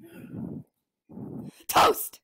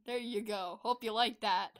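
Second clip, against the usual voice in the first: ordinary talk, nothing marked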